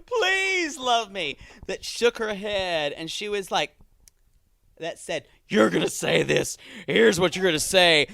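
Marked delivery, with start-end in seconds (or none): 0.0-1.4 high, slow, wailing mock plea; pleading. Host voicing Darcy's needy brain begging, tearful mock pleading. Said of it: "the part of her brain that says". 5.5-8.1 put-on commanding dark voice; dark inner voice. Host voicing Darcy's brain giving orders, dark voice. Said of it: "you described that dark voice".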